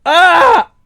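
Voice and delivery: sudden high loud yelp; sharp surprised shriek; startled yelp. Real, not acted. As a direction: yelp out in shock. Startled scream at game.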